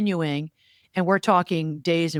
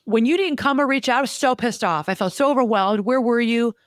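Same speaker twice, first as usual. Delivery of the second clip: mock angry complaint; 5 semitones higher than usual. Shown as a demonstration. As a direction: let the anger out. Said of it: "that's our anger coming out".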